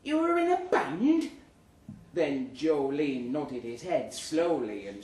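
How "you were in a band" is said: higher, amazed mock question; impressed gasp. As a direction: sound starstruck and amazed. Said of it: "Mary from accounts said".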